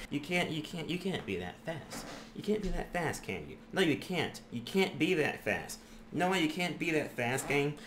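disbelieving mutter. Real, not acted. Gamer muttering at an enemy that is too fast, under his breath.

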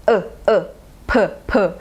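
short neutral "เออ" then "เพอ", clipped; schwa drill. Shown as a demonstration. Teacher isolating the schwa in police. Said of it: "เสียงชวาอยู่ตรงไหนเหรอคะ[name]".

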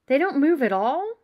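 put-on astonished young wizard; amazed. A narrator's reading. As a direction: react in open amazement, pitch leaping up. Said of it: "Ron sounded amazed".

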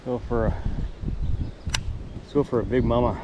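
usual speaking voice

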